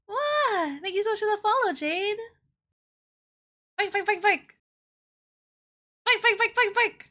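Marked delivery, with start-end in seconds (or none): 3.8-7.0 high playful puppy barks; cute anime streamer. Pretend. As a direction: yip like an excited puppy. Barking at followers.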